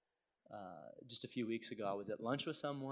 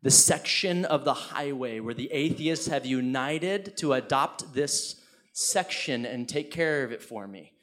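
loud, slow, incredulous proclamation; emphatic proclamation; 2 semitones higher, 14 dB louder, slower than usual. Real, not acted. Preacher reveals the atheists' highway sign to congregation, emphatic proclamation. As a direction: belt it out slowly to the whole room.

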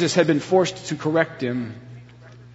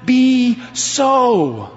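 higher, slow and emphatic, a restrained cry; inner scream; 6 semitones higher, much slower than usual. Retold or imitated. Peter inwardly protests Jesus' words, higher and drawn out. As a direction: let the denial burst out, drawn out. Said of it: "everything inside of him was screaming".

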